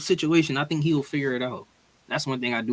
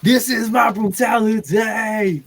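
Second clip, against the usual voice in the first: mock wrestler shout; 6 semitones higher, 3 dB louder, much slower than usual. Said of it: "I'm gonna be like".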